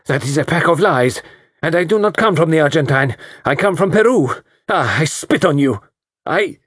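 put-on high, loud accented fury; an insulted Peruvian beauty hitting back; furious Latin diva. Peruvian woman in a rage.

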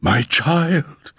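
high, stirred cry of a mother; emotional cry. A narrator's reading. Mother sees her child materialize, emotional cry. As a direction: cry out with lifted pitch. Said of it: "cried Madame X".

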